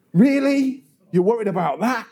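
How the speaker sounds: mock incredulity, jumping high; dismissive. People responding to someone's shared worry, dismissive disbelief. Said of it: "they dismiss them out of hand".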